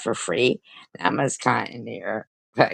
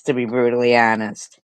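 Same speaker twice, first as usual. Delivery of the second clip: ordinary delivery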